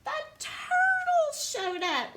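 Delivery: high-pitched storybook squeal; excited reveal. A narrator's reading. Reader announces newcomers to child, excited squeal. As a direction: burst out high with the big reveal.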